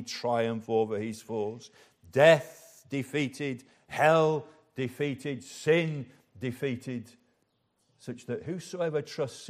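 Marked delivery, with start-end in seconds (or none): 2.1-7.1 each word hit slowly and deliberately; slow triumphant refrain. Real, not acted. Preacher proclaiming Christ's victory, slow and weighty. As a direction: let each defeat land, slowly.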